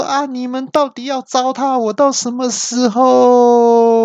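high, drawn-out angry outcry; furious yell. Retold or imitated. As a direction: howl at the GPS in exasperation. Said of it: "我就气到大叫说".

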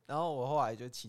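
normal talking voice

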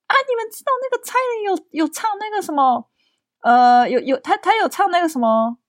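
high, eager grandma blurting it out; loud grandma. Retold or imitated. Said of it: "她就突然很大声地说".

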